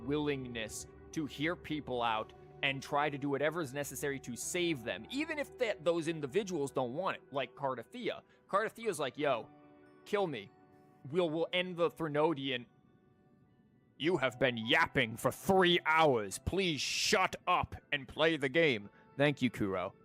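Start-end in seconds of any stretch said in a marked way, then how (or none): none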